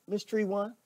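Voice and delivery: ordinary talk, nothing marked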